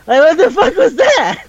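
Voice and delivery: high-pitched, incredulous outburst; amused disbelief. Real, not acted. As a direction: exclaim it high, tickled by the absurdity. Laughing disbelief at the retold scene.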